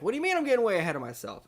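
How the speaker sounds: high, fast, protesting voice; Larry David. Acted. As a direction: protest it in a quick high rush. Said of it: "he's like".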